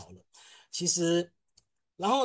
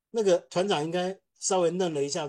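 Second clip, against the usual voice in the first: ordinary talk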